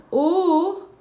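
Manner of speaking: high, loud "Oh!"; sudden loud exclamation of surprise; surprised cry. Real, not acted. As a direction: burst out, pitched right up. Sudden exclamation during livestream.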